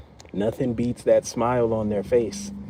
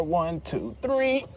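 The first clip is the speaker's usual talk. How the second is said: straining pull; 6 semitones higher, 4 dB quieter, much slower than usual. Real, not acted. Counting into a big pull.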